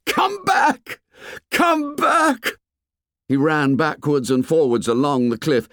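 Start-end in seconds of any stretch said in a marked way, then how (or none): 0.0-2.6 very high desperate scream. A narrator's reading. Boy on the cliff screams at the departing ship, desperate scream. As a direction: scream it out as far as it will carry. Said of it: "screamed at the ship".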